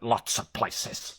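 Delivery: slow, husky near-whisper; mock mysterious movie-hero reply; film hero impression. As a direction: draw it out on a whispered breath. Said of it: "here he's like".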